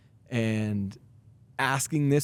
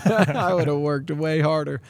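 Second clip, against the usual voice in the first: laughing; 4 semitones higher, 4 dB louder, slower than usual. Real, not acted. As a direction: repeat it through a laugh, slower and higher. Repeating himself with a laugh.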